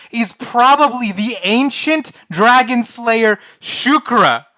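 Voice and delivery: slow, loud, high epic announcement; grand narrator voice. Acted. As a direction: proclaim it like a bard at full volume. Reading AI text dramatically.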